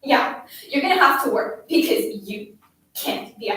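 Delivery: loud, hectoring tirade; angry scolding. A narrator's reading. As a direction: berate the child, pushing out each question. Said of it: "I scolded".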